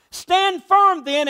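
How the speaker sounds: rising, slowed-down exhortation; emphatic command. Retold or imitated. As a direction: drive each word home slowly. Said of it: "He goes on".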